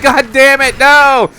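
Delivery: high, loud frustrated yell; frustrated shout. Retold or imitated. Player yelling as the fall kills him. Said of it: "I'm like".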